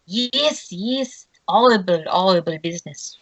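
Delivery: put-on gasping French-accented reaction; gasp, then react in a French accent. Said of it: "his French accent".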